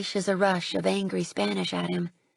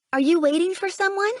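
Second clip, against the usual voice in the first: much higher, louder call across the snow; 11 semitones higher, 5 dB louder than usual. Acted. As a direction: call out to the boy in the storm. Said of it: "I call out".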